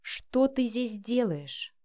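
very slow, deliberate, controlled question; spelling out each word. A narrator's reading. Son repeating his question to his mother, slowly and distinctly. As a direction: space the words out slowly, one by one. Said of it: "отчеканив слова по буквам".

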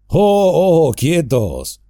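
booming halt; call to stop. Acted. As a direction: hold them back with a shout. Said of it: "gritó el personaje alzando una mano".

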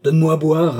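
loud, rising demand; crying out. A narrator's reading. Thirsty old man orders Asharat to bring drink, shouting. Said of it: "cria Altotas".

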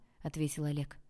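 ordinary delivery, nothing marked

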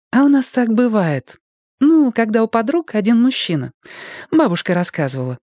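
put-on high cheerful child's voice; innocent, beaming schoolgirl; bright little girl. A narrator's reading.

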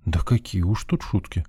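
softly sighed, resigned and sad; quiet, mournful exclamation. A narrator's reading. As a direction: sigh it out sadly, keep it soft. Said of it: "печально вздохнул я".

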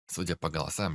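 ordinary delivery, nothing marked

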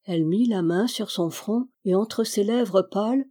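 plain reading voice

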